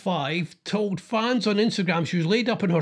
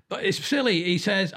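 ordinary talk, nothing marked

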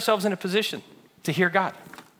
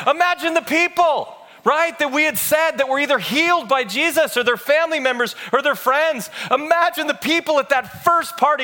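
raised, driving preacher's voice; impassioned preaching; 6 semitones higher, 3 dB louder than usual. Acted. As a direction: preach it with building fervor. Preaches at full force.

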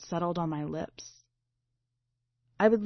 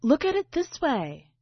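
insistent; 7 semitones higher, 4 dB louder than usual. Acted. Narrator pushes the fragment forward.

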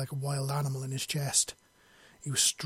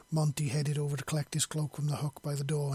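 ordinary delivery

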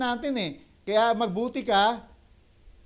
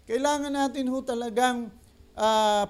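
usual speaking voice

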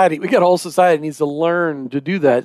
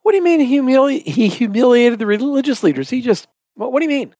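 surprised disbelief; 6 semitones higher than usual. Real, not acted. Host startled by humiliated claim.

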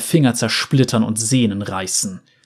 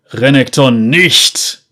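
slow, loud roar; 5 dB louder, slower than usual. A narrator's reading. Nasus tries to stop his brother, roaring. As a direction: stop him with a desperate roar. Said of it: "brüllte Nasus".

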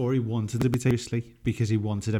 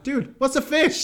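high quoted voice; 4 dB louder than usual. Retold or imitated. Quoting Loki scene, high voice.

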